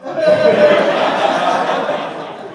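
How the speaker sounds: loud, very high airy laugh; real burst of laughter. Real, not acted. Laughs at punchline.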